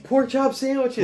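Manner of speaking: high, slow, goofy cartoon shout; dubbed cartoon voice. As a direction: stretch it out like a silly redubbed cartoon hero. Quoting dubbed G I Joe cartoon.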